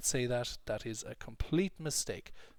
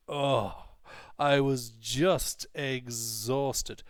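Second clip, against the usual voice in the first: slow, louder, JUST and EXHAUSTED stretched out hard; intense emphatic intonation; 5 dB louder, much slower than usual. Shown as a demonstration. Teacher demonstrating emphatic "just" to students, intense intonation. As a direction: lean heavily on "just exhausted", drawing out each word. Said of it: "spoken with an intense intonation".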